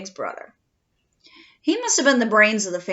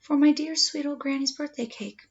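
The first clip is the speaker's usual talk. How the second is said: usual speaking voice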